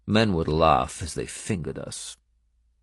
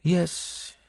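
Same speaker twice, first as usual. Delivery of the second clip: Benedetta's voice; 11 semitones higher than usual. Acted. Benedetta's one-word answer.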